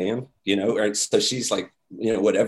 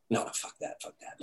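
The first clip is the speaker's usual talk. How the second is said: insistent whisper; 13 dB quieter than usual. Retold or imitated.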